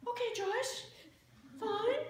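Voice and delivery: meek high reply. Retold or imitated. Preacher mimicking her timid answer to the boss, high-pitched. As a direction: squeak it like a nervous pastor agreeing. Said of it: "She'd just call up and say".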